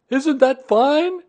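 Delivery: high, loud, stretched-out call; Pooh, shouting down. A narrator's reading. Pooh shouting down to the boy from the sky. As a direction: shout proudly from up in the air. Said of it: "shouted Winnie the Pooh down to you".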